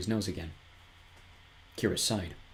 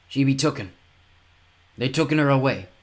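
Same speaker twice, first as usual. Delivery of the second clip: hoarse fen woman; 5 semitones higher, 6 dB louder than usual. Acted. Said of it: "her voice a hoarse whisper".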